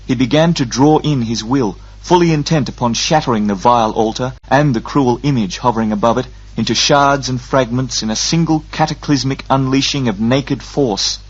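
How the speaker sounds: ordinary delivery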